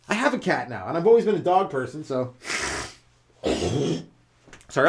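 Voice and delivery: normal talking voice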